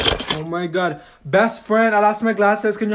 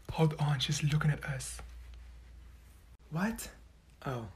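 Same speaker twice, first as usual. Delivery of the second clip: urgent hushed whisper; 6 semitones lower, 20 dB quieter than usual. Acted. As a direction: whisper so the teacher won't hear. Secret chat caught by teacher.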